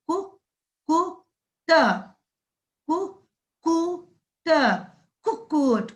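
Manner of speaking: कु, कु, त split then blended into कुक्कुट; syllable by syllable. Shown as a demonstration. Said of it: "इसका उच्चारण इस प्रकार होता है".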